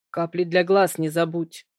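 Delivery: ordinary delivery, nothing marked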